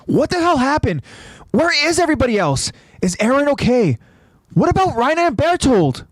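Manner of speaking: high-pitched barrage of questions; urgent questioning. A narrator's reading. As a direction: demand answers urgently. Connie fires questions at Titan.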